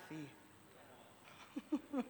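breathy whisper, barely voiced. Real, not acted. Preacher whispers to the congregation, tender whisper. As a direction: whisper it close to the mic.

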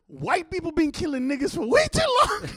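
retold outburst, climbing to a high yell; impassioned shout. Man shouting his reason at the other, impassioned outburst. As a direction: build to a loud, high cry on the last words.

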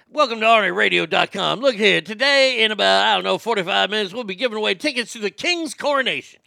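loud, fast radio announcer hype. Acted. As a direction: hype it like a morning DJ. Radio DJ imitation.

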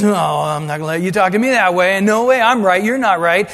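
quick, defiant mock of proud arguing; arrogant retort. Pride talking back in a quick, defiant retort. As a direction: sneer it fast like a stubborn spouse. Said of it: "our arrogance says".

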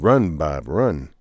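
ordinary delivery

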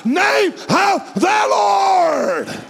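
long, slow, high shouted praise; climactic shout. Acted. Preacher crowns the sermon, slow high shout. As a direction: stretch it out and shout it to the rafters.

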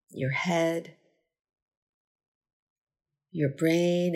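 unhurried meditation guide, long pauses between phrases; measured, spacious gratitude-practice delivery; guided meditation. Acted. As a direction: lead a body scan, giving each phrase space to settle. Leads a gratitude body scan.

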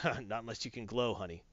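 low, sly put-on alien voice; sly Skrull. Skrull teasing Monica, low and sly. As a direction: drop low and tease her slyly. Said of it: "the Skrull guy goes".

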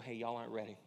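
soft, gentle warning as Jesus; loving warning. Retold or imitated. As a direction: lovingly tell them they're not ready. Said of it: "And so lovingly, affectionately".

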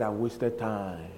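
soft, low, drawn-out words; retold dismay. Brother Hagin told he wasted time, hushed dismay. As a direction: trail it out slowly and quietly.

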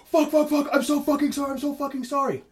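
high, muted mock shriek of panic; hammed-up desperate apology; panicked apology. Acting out panicked driver apologizing after crash.

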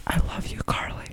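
breathy unvoiced whisper of "I love you, Carly". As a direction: whisper it like a secret confession. Quoting iCarly butter sock.

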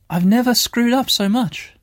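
high exasperated disbelief. Real, not acted. Failing the trap.

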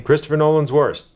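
loud, high pronouncement; emphatic verdict. Real, not acted. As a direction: declare it like a verdict. Declaring verdict.

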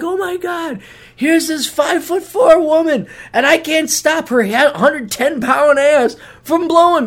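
mock helpless guy. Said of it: "And I'm like".

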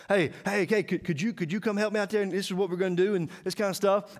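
acted-out eager invitation; eager recruiting. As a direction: coax a friend along, stammering a little. Acting out recruiting helpers.

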